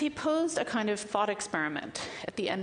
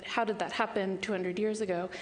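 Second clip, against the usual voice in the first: usual speaking voice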